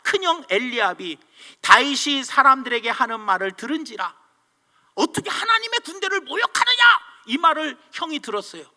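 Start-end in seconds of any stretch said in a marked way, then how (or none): 4.9-7.1 high, outraged quoted challenge; retold indignation. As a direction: cry out in acted outrage. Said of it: "열받아가지고 다 이야기를".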